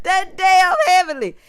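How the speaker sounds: high, loud laughter spilling into words; loud laughing cackle. Real, not acted. Vlogger cracking up at the guest's antics, loud and high.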